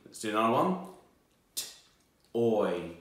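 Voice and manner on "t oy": T-oy segmented into T and oy; sounding out words. Shown as a demonstration. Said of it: "for me to sound out some words".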